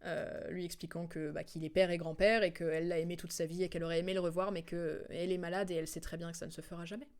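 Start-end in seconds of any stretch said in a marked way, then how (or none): none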